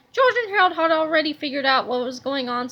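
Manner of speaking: plain reading voice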